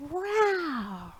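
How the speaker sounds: a light, playful growl; demo tiger growl. Shown as a demonstration. Teacher showing children the tiger noise, mock growl. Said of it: "So then you can go".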